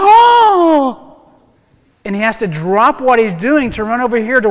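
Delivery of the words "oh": sudden high loud yelp of surprise; startled oh. Pretend. God caught off guard, a sudden surprised yelp. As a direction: jump at it like you just noticed. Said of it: "he turns around and goes".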